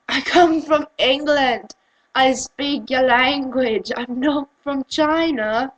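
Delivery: slow, over-enunciated comeback; mock slow retort. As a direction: spell it out slowly, sarcastically. Slow retort mimicking patronizing teacher.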